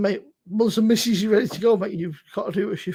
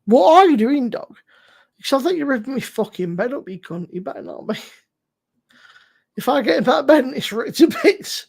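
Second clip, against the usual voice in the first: scolding the dog; 5 semitones higher, 6 dB louder than usual. Real, not acted. Scolding dog ripping the bed.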